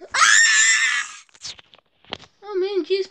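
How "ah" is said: sudden short cartoon scream; angry scream. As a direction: burst out screaming. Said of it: "calm down, dude".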